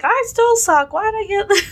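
high fake crying whine; whiny crying. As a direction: whimper it like a sore loser.

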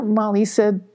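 ordinary delivery, nothing marked

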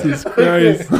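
loud, high, breaking into laughter; can barely get the words out for laughing; laughing hard. Real, not acted. Cracking up at a joke.

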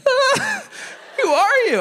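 high-pitched, startled reaction; startled yelp. Retold or imitated. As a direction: squeal it like someone caught off guard. Startled woman in retold encounter.

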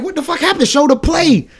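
high-pitched, urgent retelling; retold agitated demand. Retelling watching the game, high agitated demand. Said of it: "I'm like".

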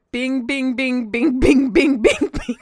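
bings read out as separate spoken words; reading off the sheet. Retold or imitated. Cohost reading the transcribed call off the run sheet, recited. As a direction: recite each bing like a written list. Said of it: "it says next on my sheet".

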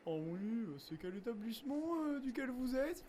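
put-on chatty voice climbing at the end; evasive passenger. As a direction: imitate someone dodging with an innocent question. Imitates evasive passenger's small talk.

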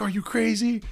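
high, held-back imitation of shrieking; mock screaming. Nurses to the man who drank it, mock scream. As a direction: imitate horrified nurses at reduced volume. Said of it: "they started screaming".

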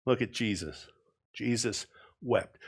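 suddenly low and soft after the build-up; hushed sincerity. Real, not acted. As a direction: drop your voice for a solemn moment. Hushed drop after building list.

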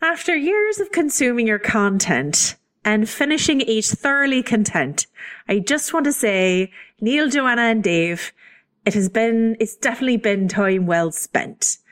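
hammed-up amateur Irish accent; lilting, badly faked Irish accent. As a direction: read the limerick in a put-on Irish brogue. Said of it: "There's an accent request".

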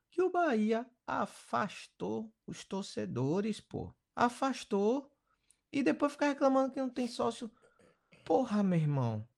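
high, indignant emphasis; indignant rant. Real, not acted. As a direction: rise up in indignation. Fan venting about club.

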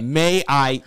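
lightly put-on American accent. Imitating Martin Freeman asking to sound American, American accent. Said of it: "This is my American accent".

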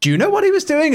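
incredulous. Retold or imitated. Retelling an incredulous question.

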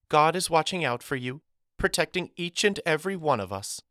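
high, sugary teacher voice; falsely sweet reassurance. A narrator's reading. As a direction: reassure the class too sweetly to be believed. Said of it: "chirped in tones far too sweet to be authentic".

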